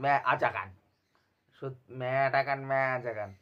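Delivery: ম্যা আজা গান repeated as a sneering parrot; mocking the wrong name. Vlogger imitating reviewers' wrong ম্যা আজা গান, sneering. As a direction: echo the botched ম্যা আজা গান scornfully. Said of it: "উচ্চারণ করে".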